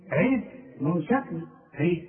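ordinary talk

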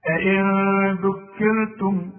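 أئن ذكرتم stretched slowly, nun hidden in a nasal hum; إخفاء بغنة; slow tajweed recitation. Shown as a demonstration. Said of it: "الإخفاء".

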